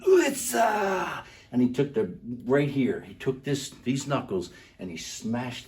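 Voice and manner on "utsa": sharp high kiai shout; martial arts yell. Retold or imitated. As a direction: belt out the battle cry. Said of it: "Key out as loud as anything".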